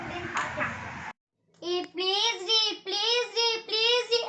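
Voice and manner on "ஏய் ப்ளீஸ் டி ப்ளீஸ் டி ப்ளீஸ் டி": whiny begging. Acted. Begging repeatedly for biscuit.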